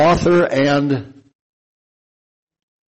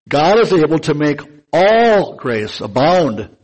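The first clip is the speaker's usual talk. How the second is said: "all" punched loud inside "God is able to make all grace abound"; preacher hammering "all grace abound"; emphasized words; 4 dB louder than usual. Shown as a demonstration. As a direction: press hard on "all", stretching it. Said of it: "I'm gonna emphasize some words here".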